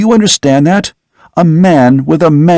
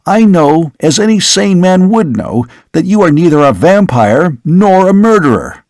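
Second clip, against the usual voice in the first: higher, urgent denial; vehement protest; 5 semitones higher than usual. Acted. Son jumping up to deny his father is a vampire, higher and urgent. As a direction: push back hard, pitch up.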